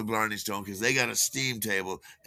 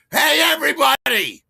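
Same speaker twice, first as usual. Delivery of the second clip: much higher, loud barked call to the room; upset shout; 12 dB louder than usual. Retold or imitated. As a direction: yell to get the whole bar's attention. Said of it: "he's upset about something".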